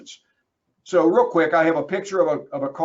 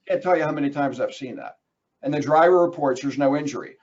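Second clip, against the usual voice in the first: usual speaking voice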